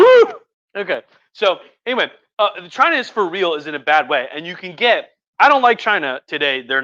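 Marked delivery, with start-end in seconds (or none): 0.0-0.8 high, loud party whoop; piercing, celebratory "woo". Real, not acted. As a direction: let out a sudden high holler. Speaker whoops after aside.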